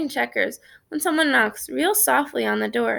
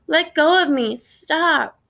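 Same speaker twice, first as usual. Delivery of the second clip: put-on screaming kid; 6 semitones higher, slower than usual. A narrator's reading. Little brother to older brother, screaming to be let go. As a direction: cry out to be let go. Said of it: "he's screaming his head off".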